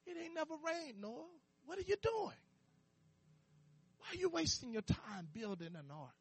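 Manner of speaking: hushed, sneering mockery of the crowd; mocking scoffers. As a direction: scoff at Noah under your breath. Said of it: "that's what they were saying".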